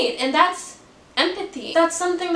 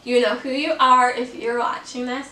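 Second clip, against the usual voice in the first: ordinary delivery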